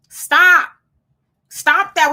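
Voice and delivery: loud, high stern stop; firm command. Real, not acted. Host warning viewers, loud stern stop. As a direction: order them to stop, loudly. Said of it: "stop being out by yourself".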